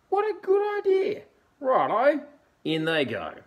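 very high, loud cheeky dingo. Acted. Dingo voiced in story.

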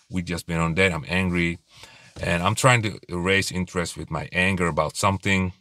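ordinary delivery

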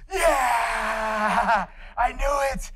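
thrilled. Retold or imitated. Teen thrilled to hear he could be an artist. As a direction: burst out with delight. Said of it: "And I went".

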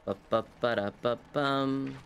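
ordinary delivery

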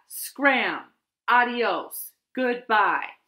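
loud, emphatic send-off with falling endings; firm dismissal. A narrator's reading. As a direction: send them packing, each word a command. Squirrel's last note ordering Bat out.

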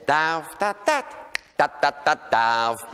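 chanted "Darf, da, da, da, da, darf" with a held eighth-note pause; Rhenish mocking rhyme. Shown as a demonstration. Comedian performing the famous Rhenish mock verse in rhythm. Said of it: "rheinische Spottvers mit der berühmtesten Achtelpause".

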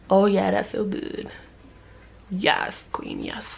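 soft whispered bliss; relieved whisper. Real, not acted. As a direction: breathe it out in pleasure. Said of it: "I'm scratching my ankle".

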